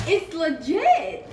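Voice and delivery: high, loud, stretched-out delight; excited drawn-out exclamation; thrilled. Real, not acted. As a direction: draw it out loudly with glee. Thrilled by the new tripod.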